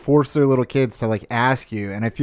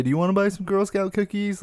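put-on higher, softer little-girl sales pitch; cute little girl; 7 semitones higher, 4 dB quieter than usual. Little girls at the grocery store asking passers-by, sweetly. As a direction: ask sweetly like a small girl. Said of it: "who actually say".

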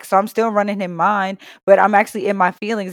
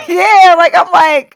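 laughing; 9 semitones higher, 8 dB louder, slower than usual. Real, not acted. Laughing retelling, loud and high.

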